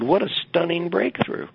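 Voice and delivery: high, slow, dripping sarcasm; mock amazement at an obvious point; sarcastic advisor. Advisor mocking his grad student, high slow sarcasm.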